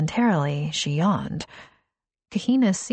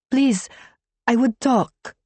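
higher, foreign-voiced woman; Kahina's accented voice; 6 semitones higher, 3 dB louder than usual. A narrator's reading. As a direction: speak haltingly in English. Kahina speaks at the door.

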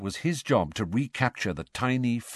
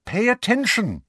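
cross; 12 semitones higher, 4 dB louder than usual. Acted. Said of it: "said Angelina crossly".

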